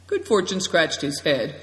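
ordinary delivery

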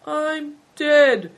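high, drawn-out mock dying voice; feigned faintness. A narrator's reading. Hailstone on the ground to the prince, mock faint. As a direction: play the feeble victim, pitched high. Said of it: "said Hailstone faintly".

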